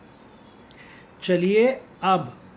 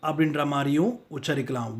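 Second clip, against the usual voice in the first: ordinary talk, nothing marked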